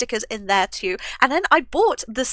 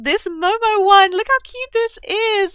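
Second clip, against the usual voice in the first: high, slow coo of delight; high-pitched, stretched-out adoration; gushing; 9 semitones higher, 5 dB louder than usual. Real, not acted. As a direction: coo over something cute, drawing it out. Gushing over a cute sticker.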